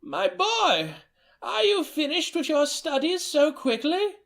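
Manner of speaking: high quavering grandmother voice; a doting old nana greeting her boy; delighted old woman greeting her grandson, high-pitched. Acted. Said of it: "When old Emmer Joe answered".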